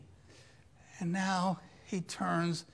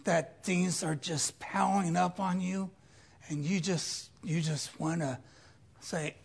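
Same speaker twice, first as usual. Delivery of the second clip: ordinary delivery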